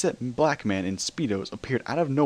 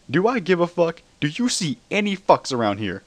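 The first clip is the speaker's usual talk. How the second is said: higher and louder, yelling defiance; shouting; 8 semitones higher, 5 dB louder than usual. A narrator's reading. Spider-Man yells at Luna during the jailbreak. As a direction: yell it at the top of your lungs. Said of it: "shouted at the top of his lungs".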